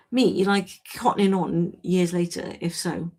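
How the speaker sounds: ordinary talk, nothing marked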